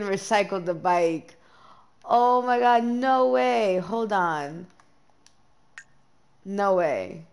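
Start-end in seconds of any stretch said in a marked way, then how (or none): 2.0-3.8 loud and higher, oh my God no way; real loud shock; shocked outcry. Real, not acted. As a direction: disbelief bursting out. Realises she recycled bike.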